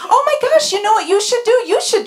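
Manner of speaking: hammed-up overexcited advice-giver; gushing know-it-all. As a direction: push the chirpy gush even further up. Repeats gushing adviser line higher.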